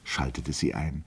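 ordinary delivery, nothing marked

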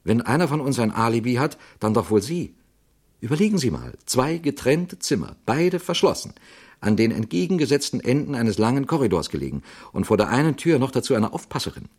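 high-pitched, insistent argument; exclaimed protest. A narrator's reading. Actor defends the Italian's alibi to Father Brown, protesting. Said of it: "rief der Schauspieler".

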